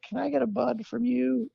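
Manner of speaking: high, soft wheedle imitating a friend; wheedling request. Paul and Eric asking him for a bud, high soft mock wheedle. Friends cadging weed, acted.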